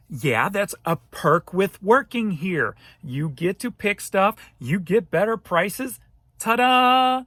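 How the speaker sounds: slow, loud, teasing explanation ending in a big ta-da; telling off the complaining reseller with a sarcastic ta-da; mock cheery sarcasm. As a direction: lay it out slowly and sarcastically, then cap it with a flourish. Mocking the angry reseller's complaint.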